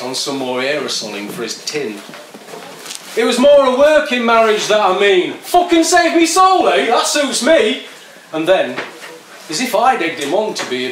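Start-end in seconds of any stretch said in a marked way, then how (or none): 3.1-5.3 loud, high sneering imitation of the poet; mocking parrot. Skinhead parrots the poet's line. 5.3-7.9 much higher, loud mocking scoff; scornful jeer. Skinhead scoffs at poet.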